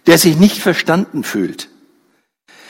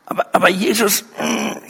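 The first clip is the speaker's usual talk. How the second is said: church leader objecting; 4 semitones higher than usual. A narrator's reading. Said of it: "der Gemeindeleiter denkt".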